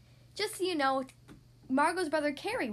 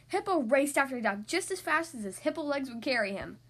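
usual speaking voice

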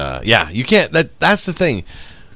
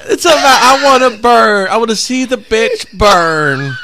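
higher, louder cartoonish villain impression; mocking the guy; 13 semitones higher, 8 dB louder than usual. Retelling the guy wanting to burn her, loud mock voice. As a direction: play him as a gleeful, unhinged creep. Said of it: "He's like".

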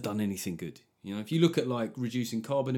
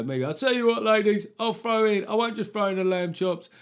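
put-on much higher, loud barrow-boy patter; market meat-van trader; 7 semitones higher, 4 dB louder than usual. A comedian imitating a meat-van trader pitching to ladies, high and loud. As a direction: flog the meat like a market-stall hawker shouting the deal. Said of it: "they go".

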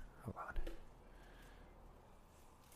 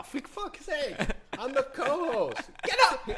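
high, loud hammed-up protest; mock outrage; 6 dB louder, slower than usual. Ousted co-host protesting the vote, mock outrage. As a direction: yell like you're being hauled away, for laughs.

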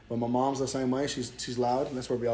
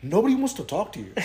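louder scolding; 7 semitones higher, 7 dB louder than usual. Retold or imitated. Cousin scolding him.

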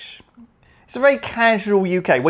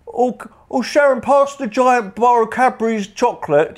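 British driver voice; 6 semitones higher than usual. Retold or imitated. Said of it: "you can hear 'em all saying".

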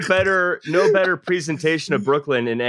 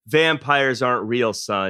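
stern dad; 5 semitones lower than usual. Retold or imitated. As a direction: let the son down gently. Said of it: "his dad was like".

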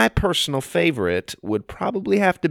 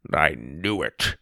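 low, soft, slow grumble; grumbling; 8 semitones lower, 5 dB quieter, slower than usual. A narrator's reading. Grandpa working out where they went, low grumble. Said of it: "he grumbled".

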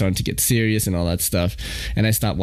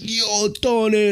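higher, slow Brooklyn Italian greeting; Italian Brooklyn accent; 11 semitones higher, slower than usual. Retold or imitated. Imitating a stranger's Italian Brooklyn accent on the phone, slow and higher. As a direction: call out Tony like a Brooklyn Italian guy. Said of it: "he sounded like an Italian guy from Brooklyn".

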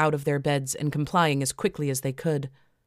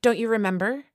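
higher-pitched, knowing question; lightly pointed, pitched up; rhetorical question; 7 semitones higher than usual. A narrator's reading. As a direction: ask it knowing the answer. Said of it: "asked Elizabeth rhetorically".

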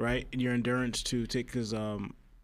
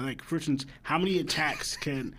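normal talking voice

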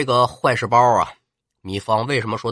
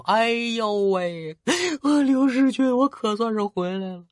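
crying out; 9 semitones higher than usual. Acted. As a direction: blubber with relief at reaching him. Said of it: "见到玄德之后就哭". (糜芳见到刘备，哭腔哀号)

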